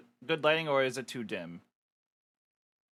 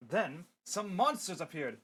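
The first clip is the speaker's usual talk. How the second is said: ordinary delivery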